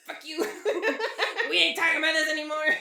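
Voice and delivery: laughing through the words; giggling mock protest; real laughter breaking through. Real, not acted. Laughing banter.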